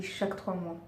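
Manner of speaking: ordinary talk, nothing marked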